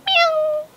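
high mewing cat sound; high cat meow. A narrator's reading. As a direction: mew like a sleepy cat. Cat answers in story.

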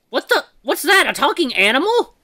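high, loud disbelief; startled outburst at an impossible sight; shocked. Acted. Shocked by a talking animal.

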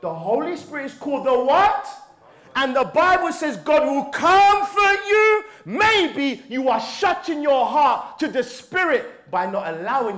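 high, loud, impassioned exhortation; full-force preaching. Real, not acted. Preacher urging brothers to open their hearts, shouting passionately. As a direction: build into a shout and hold it.

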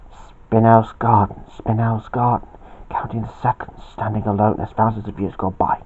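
very soft, fading toward a whisper; whispered murmur. A narrator's reading. Bobby calms himself in his happy place, soft whisper. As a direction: murmur it softly to yourself.

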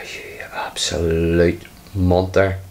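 slow whispered sigh of defeat; whispered exasperation. Real, not acted. Player sighs at his rival, slow defeated whisper. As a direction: breathe it out slowly, beaten.

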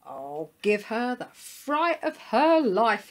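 slow, high and loud relish; drawn-out mischievous dog voice; gleeful chuckled scheming. A narrator's reading. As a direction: gloat playfully over a prank. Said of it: "he chuckled to himself".

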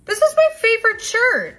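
offended shriek. Acted. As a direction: cry out, deeply offended. Headphones reveal she hates shirt.

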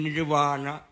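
"นิวานะ" with a w/v sound, long aa; Sanskrit reading. Shown as a demonstration. Lecturer giving the Sanskrit form, "นิวานะ" with long middle vowel. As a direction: say "นิวานะ" as nir-va-na. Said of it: "สันสกฤตคือ".

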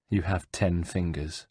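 low, soft, disappointed murmur. Acted. Ezio murmurs to himself over the monk, low and soft. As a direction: murmur it to yourself, let down. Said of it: "he murmured".

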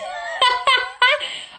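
loud high cackle of laughter; helpless high laughter; real laugh. Real, not acted. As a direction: burst out laughing. Laughs at nonsense phrase.

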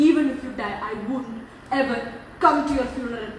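low, soft, broken into separate phrases; quiet bitter vow. Real, not acted. Daughter refusing to attend the funeral, low and quiet. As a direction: drop the voice and refuse with finality. Cold vow during family quarrel.

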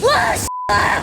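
loud cry of fright; startled shout. Real, not acted. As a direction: yell at the sudden shock. Jump scare.

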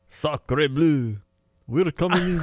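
high, hammed-up French; mock French accent. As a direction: announce yourself as a cartoon French invader. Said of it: "That's your French?".

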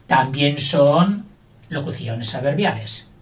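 normal talking voice

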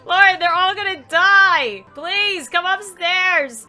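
high, loud mock-desperate wail; exasperated pleading. Player begging a sim to save the toddlers, exasperated pleading. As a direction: plead in hammed-up panic.

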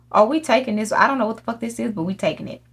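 ordinary talk

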